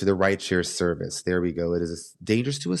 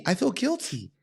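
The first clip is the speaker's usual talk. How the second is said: guilty whine; 11 semitones higher than usual. Real, not acted. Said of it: "but then I feel guilty".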